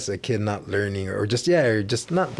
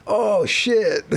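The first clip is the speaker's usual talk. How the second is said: high, louder mock dismay; caught-out surprise; 11 semitones higher, 4 dB louder than usual. Clerk realizing the boy apologized, high mock dismay. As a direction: voice the clerk realizing he was wrong. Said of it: "And then the guy's like".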